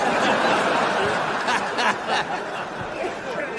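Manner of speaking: cackle. Acted. After the heckle.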